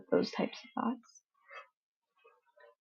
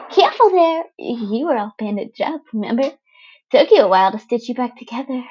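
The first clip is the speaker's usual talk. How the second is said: put-on gleeful teen villain chirping at the wounded boy; bubbly villain girl Toga; 2 semitones higher, 5 dB louder, faster than usual. Toga warns playfully.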